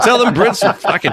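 high-pitched, worked-up rant of a patriotic vet; riled army-vet buddy. Retold or imitated. Army vet buddy objecting to talk with Brits, riled-up imitation. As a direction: imitate your indignant veteran buddy, pitch way up. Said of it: "he's an army vet".

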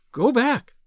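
high, loud-edged urgent command; urgent warning. A narrator's reading. Woman warning a stranger on the moor, urgent. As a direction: order him away, pitched high.

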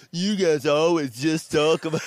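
loud, high mocking hater voice, laughing; mock a complaining listener through laughter; negative tweet voice. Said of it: "if it's negative".

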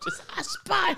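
very high, slow squeaky voice. Pretend. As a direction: squeak it out like a cartoon. Mocking the sponge victim.

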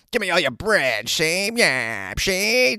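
put-on high, punchy old-movie gangster voice; mock prohibition-era robber; old-timey gangster. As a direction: demand it like a vintage mobster. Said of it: "Did he also have a voice like this?".

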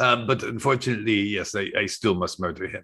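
lightly put-on Croatian accent in broken English. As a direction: play a Croatian hitman, mildly accented. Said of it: "This is Croatian accent".

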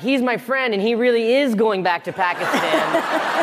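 high, laughing explanation; lightly voiced man in a cab; cab passenger. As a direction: vouch for your friend cheerfully. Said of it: "he's like".

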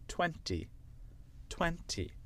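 British twenty with a crisp T; British demo, second T of twenty sounded; British accent. Shown as a demonstration. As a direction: say twenty the British way, twen-tee. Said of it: "avec l'accent british".

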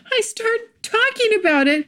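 high, laughing through the words; helpless giggly retelling. Real, not acted. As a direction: tell it while cracking up. Laughing at her own babbling.

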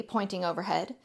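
plain reading voice

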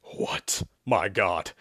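hushed, airy gasp of shock. A narrator's reading. Exorcist stunned that it is his face, gasping. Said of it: "Sherman asked in a gasp".

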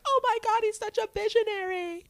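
high, gushing fangirl voice; mocking fan gush. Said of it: "everyone's like".

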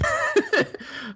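high-pitched giggling squeal; high squeal laugh. Real, not acted. Embarrassed laughter.

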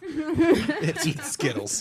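high, slow laughing line. Real, not acted. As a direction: say it while cracking up. Laughing joke about eating.

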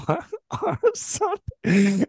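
words squeezed out through laughter, slow; laughing. Real, not acted. Telling the radio host the story, laughing.

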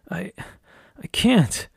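halting, voice jumping high on the last word; distressed struggle. A narrator's reading. Entranced woman tells her helper she cannot resist, in halting distress. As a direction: struggle to get the refusal out, pitched high. Haley struggles against his control.

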